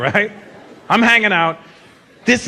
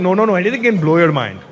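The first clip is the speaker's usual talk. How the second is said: put-on emphatic Indian accent. Indian fan correcting the comedian's wording, insistent Indian accent. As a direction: insist like an Indian fan, stretching the key word. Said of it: "emphatic with their compliments".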